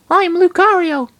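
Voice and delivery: very high, loud creature voice; Lucario's voice. Acted. Lucario introducing himself, very high and loud. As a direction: squeak the name proudly.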